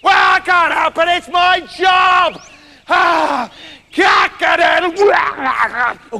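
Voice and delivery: high, loud, indignant squawk; drawn-out rooster protest; crowing rooster. Acted. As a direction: squawk it out helplessly, building into a crow.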